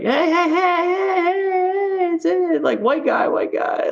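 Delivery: high-pitched excited calls of hey; calling out. Retold or imitated. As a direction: shout hey at a passing foreigner. Locals calling out at him.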